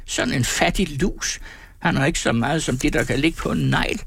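high-pitched contemptuous scolding; woman's scornful shrieking. A narrator's reading. Insulted woman belittling her penniless man, high angry scolding. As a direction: heap scorn on him, pitch well up. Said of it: "skreg konen".

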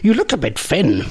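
very high, insistent mock-Irish voice; weird Irish accent. Host pressing a biscuit on Kylie, weird mock-Irish accent. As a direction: keep pushing food in a silly Irish accent. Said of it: "talking in that weird Irish accent".

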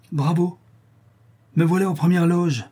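a touch of mocking delight; gleeful spectator. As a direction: applaud the show with relish. Boy applauds as gleeful spectator.

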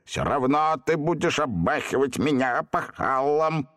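capricious. A narrator's reading. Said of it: "капризно проговорил".

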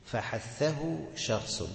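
stretched, measured recital; slow recitation. Shown as a demonstration. Teacher slowly reciting the mnemonic to the class. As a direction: draw out each word for students. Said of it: "teacher slowly recites mnemonic".

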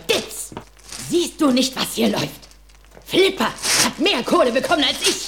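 high, loud, outraged complaint; furious rant. Acted. Heiress rages at her lawyer about the dolphins, high and loud. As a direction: vent her fury at her lawyer.

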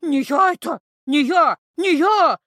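loud, high hysterical denial; panicked screaming denial. A narrator's reading. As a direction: thrash and shriek it out. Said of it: "забилась в истерике нежить".

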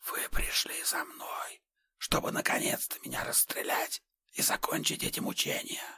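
faint breathy whisper; barely a whisper, hopeless. Acted. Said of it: "Его голос стал совсем тихим".

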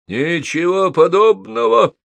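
high, drawn-out outraged denial; thundering. A narrator's reading. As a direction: thunder it back, stretching the words. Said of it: "прогремел Холл". (прогреметь, возмущённо и протяжно)

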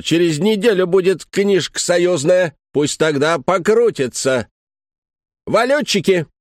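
rant, jabbing the paper at him; angry rant. A narrator's reading. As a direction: ticket woman ranting at the foreigner. Ticket woman rants shoving a form.

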